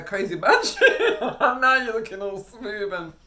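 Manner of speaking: laughing out loud mid-sentence. Real, not acted. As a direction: crack up while describing him. Laughing at teacher memory.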